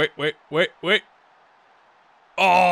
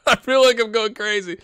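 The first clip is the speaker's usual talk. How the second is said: higher and louder, laughing through the words; laughing exasperation; 8 semitones higher, 6 dB louder than usual. Real, not acted. Vlogger baffled by the game changes, laughing helplessly. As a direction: laugh helplessly at your own confusion.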